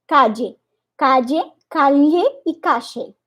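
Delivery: "calle" with dj, then l, then sh; three ll sounds. Shown as a demonstration. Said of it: "a mesma palavra calle pode ser".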